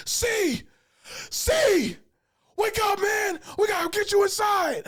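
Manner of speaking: high, loud shouts to wake someone; loud wake-up yelling. Retold or imitated. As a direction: shout to rouse a sleeping friend. Homie waking him at home.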